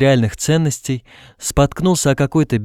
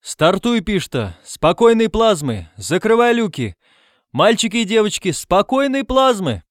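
high-pitched, carrying farewell shout; raised voice, stretching the last farewell; calling out orders; 10 semitones higher than usual. A narrator's reading. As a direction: shout launch orders across the field.

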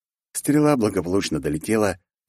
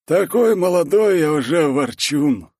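higher, slow teasing dwarf voice; amused dwarf, no real laugh; chuckling dwarf; 9 semitones higher, 4 dB louder, slower than usual. A narrator's reading. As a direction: tease a friend, pitched up and drawn out. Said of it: "хохотнул гном".

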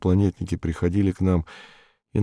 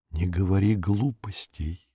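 softer, stretched-out repeat; drawn-out repeat; 6 dB quieter than usual. A narrator's reading. She repeats herself to silence his doubts, slow and soft. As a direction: repeat it slowly, dropping the volume. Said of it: "повторила я".

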